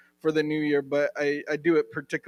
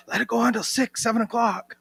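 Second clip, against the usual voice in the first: higher-pitched imitation of an extrovert; eager extrovert; 7 semitones higher than usual. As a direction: playfully mimic an outgoing friend, pitched up. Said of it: "She's an extrovert. She's like".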